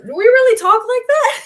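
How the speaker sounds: high, loud disbelief; shocked outburst, way up high and loud; man stunned by his own accent; incredulous. Retold or imitated. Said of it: "he's like".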